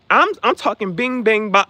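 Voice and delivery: loud, punchy sound words; loud emphatic. Shown as a demonstration. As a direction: punch each sound word out. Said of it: "when I say that".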